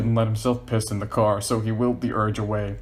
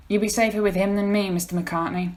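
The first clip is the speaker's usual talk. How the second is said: lady's voice; 10 semitones higher than usual. Acted.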